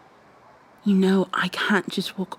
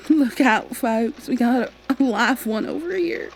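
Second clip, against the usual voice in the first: higher, mock call to the room with a laugh; mock announcement; 5 semitones higher than usual. Coworker mocks her to the room.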